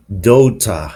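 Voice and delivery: "daughter" ending in "ah", no R; British non-rhotic R. Shown as a demonstration. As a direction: drop the R of "daughter" for an ah. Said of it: "the R at the end of words isn't pronounced".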